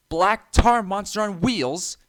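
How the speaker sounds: high, loud, slow offended snort; indignant snort. A narrator's reading. James scoffing at the trucks' insult, indignant. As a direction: repeat the insult in outrage. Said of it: "he snorted".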